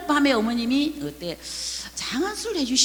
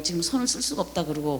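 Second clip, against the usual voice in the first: ordinary delivery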